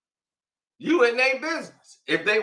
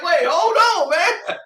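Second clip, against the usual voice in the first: pleading; 8 semitones higher, 4 dB louder, slower than usual. Retold or imitated. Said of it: "I'm like".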